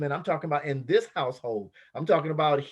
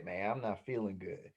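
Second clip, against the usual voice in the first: low, much softer, drained mumble; weary complaint; 9 semitones lower, 11 dB quieter than usual. Retold or imitated. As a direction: sag into a tired groan of a reply. Tired complaint when asked.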